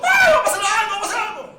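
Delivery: high, loud panicked shriek; panicked shout. Retold or imitated. Wife screaming in panic, loud and high.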